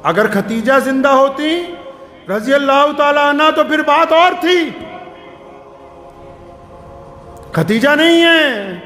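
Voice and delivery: high, ringing sermon delivery; impassioned preaching. Real, not acted. Preacher challenging village councils over marriage, impassioned preaching. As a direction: challenge the congregation from the pulpit.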